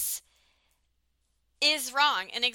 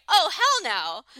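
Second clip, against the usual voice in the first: louder, higher, drawn-out retort; indignant refusal; 4 semitones higher, 6 dB louder, slower than usual. Retold or imitated. Host voicing a woman refusing his excuses, indignant. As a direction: stretch it out in mock outrage.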